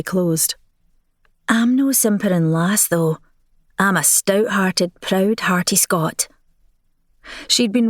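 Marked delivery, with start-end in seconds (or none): 1.5-6.3 put-on Helia, proud Scot, lets her Scottish accent through; Scottish brogue. Helia insists to the Marquis she's a proud Scot, light Scottish brogue. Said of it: "by that ghastly accent".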